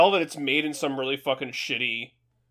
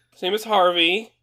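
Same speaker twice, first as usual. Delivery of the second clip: ordinary delivery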